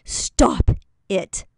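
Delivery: loud, sharp fatherly stop it; dad's stern command. Retold or imitated. Dad scolding his acting-up kid, loud and stern. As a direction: snap it like a strict father. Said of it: "He'd be like".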